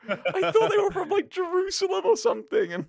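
high, loud flustered wail; embarrassed protest. Real, not acted. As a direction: protest loudly through your embarrassment. Laughing, defending an embarrassing mistake.

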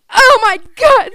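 slow, loud, high "oh my God"; shocked exclamation. Retold or imitated. Teacher hearing the kid pooped, loud slow shock. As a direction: draw it out in loud horror. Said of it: "she was like".

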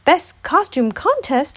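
high, slow, astonished Tigger; exclaiming. A narrator's reading. Tigger hears of a costume contest, exclaiming. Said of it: "exclaimed Tigger".

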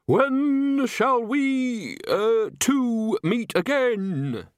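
high, slow, shrieking witch cry; eldritch shriek. Acted. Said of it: "An eldritch voice shrieked".

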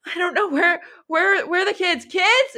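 high, loud desperate cry; panicked yelling. Acted. Husband yelling in fear for his children. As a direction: call out for the kids in terror. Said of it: "he starts, like, yelling".

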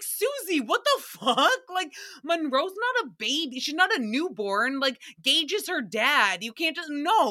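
high-pitched, incredulous telling-off; exasperated disbelief. Retold or imitated. Retells scolding Susie over her question.

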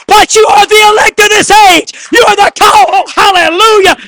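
shouted high preaching, every line hammered; preaching at full force. Acted. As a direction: pastor telling the church they are the elect, preaching at full force.

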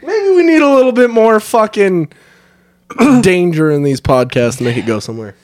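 ordinary delivery

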